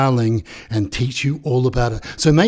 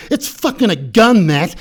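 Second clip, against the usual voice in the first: higher, louder exasperated outburst; acted yell; 6 semitones higher, 4 dB louder than usual. Genius loses patience with clown over the gun, acted yell. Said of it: "Genius yells at Clown".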